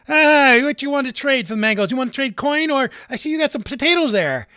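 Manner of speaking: loud, high haggling market trader; shopkeeper voice. Acted. Game master voices a pushy mango seller to the player. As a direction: hawk your mangoes loudly.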